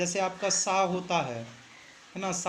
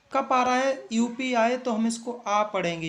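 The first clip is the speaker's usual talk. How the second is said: emphatic teacher; 5 semitones higher, 5 dB louder than usual. Shown as a demonstration. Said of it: "teacher drilling rule loudly".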